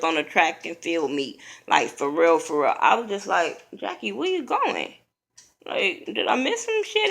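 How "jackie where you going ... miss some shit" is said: much higher, confused, calling after her; baffled call. Retold or imitated. Watching Jackie bolt over the couch, stunned and high.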